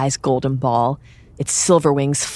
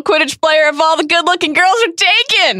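higher quoted Krum line; voicing Krum; 10 semitones higher, 3 dB louder than usual. Quoting Krum in character.

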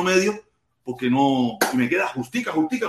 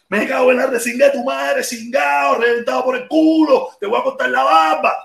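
high, raging insults hurled at the host; furious cursing; 8 semitones higher than usual. Pretend. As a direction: tear into him on live air. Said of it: "Eso es lo que va a pasar".

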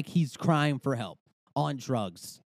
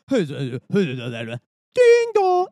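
imitated cabin chime; 2 semitones higher, 7 dB louder than usual. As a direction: blare it like a cabin announcement bell. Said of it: "That's what you hear".

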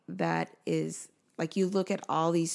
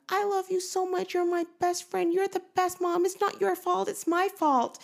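put-on much higher, earnest child voice; voicing daughter comforting; 13 semitones higher than usual. Daughter tells crying mom she's the best, high earnest child voice. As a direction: pour out comfort like a devoted little girl. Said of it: "She was like".

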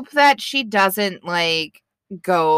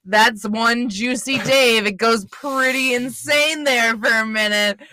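put-on higher, folksy deep Minnesotan accent; 4 semitones higher than usual. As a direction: pitch it in a hammed-up Minnesota accent. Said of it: "deep Minnesotan accent".

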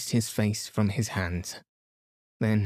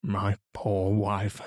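slow, understated sorrow; murmured grief; slower than usual. A narrator's reading. Inglethorpe lamenting his poor wife, a slow mild murmur. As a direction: murmur it slowly, mildly sad. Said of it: "he murmured".

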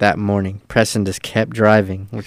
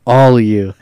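louder, drawn-out retold curse; pissed-off outburst; 2 semitones higher, 6 dB louder, slower than usual. Driver cursing the crew on his way to the van, pissed off. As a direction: imitate someone storming off furious. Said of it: "so pissed".

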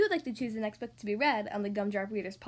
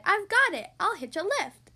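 louder, higher burst of a bright idea; excited cry; 7 semitones higher, 7 dB louder than usual. A narrator's reading. Tiny sea snail hitching a lift, crying out excitedly. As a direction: cry out a sudden plan. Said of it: "then cried".

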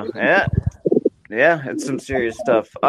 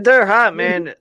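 pitched up and louder; excited; 8 semitones higher, 5 dB louder, slower than usual. Real, not acted. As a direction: blurt it out, burning. Reacting to chip heat.

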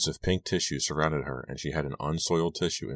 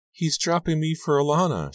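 put-on high, upset girl's whine; tearful into bedspread; 12 semitones higher, 3 dB louder than usual. A narrator's reading. Rachel face down, telling of being dropped, tearful. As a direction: pitch it high and distressed. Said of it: "she said into the bedspread".

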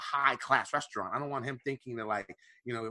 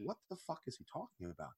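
very soft, baffled inner voice; bewildered mutter; 17 dB quieter, faster than usual. Retold or imitated. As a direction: mutter it to yourself in confusion.

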